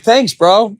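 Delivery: high, mock-cheery thanks; sarcastic. Answering the old timer who slapped his back, sarcastic. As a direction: toss it off with irony.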